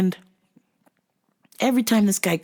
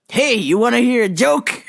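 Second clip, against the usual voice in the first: higher, pushy question; lightly voiced eager joker; 6 semitones higher, 3 dB louder than usual. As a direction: imitate someone pestering with a joke. Acting the joker pestering coworker.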